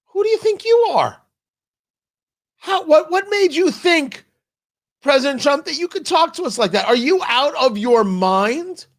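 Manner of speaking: high-pitched, acted indignation; offended, incredulous telling-off; indignant outrage. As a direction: scold someone who has insulted you. Acts out offended Danes scolding Trump.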